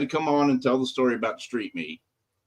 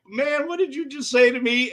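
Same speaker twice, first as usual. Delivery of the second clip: imitating friend's outrage; 9 semitones higher than usual.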